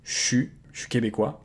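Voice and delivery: "chu" for je suis, Quebec French contraction; Québécois chu. Shown as a demonstration. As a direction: say "je suis québécois" as shoo kebekwa. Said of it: "people will actually get rid of the I and it becomes".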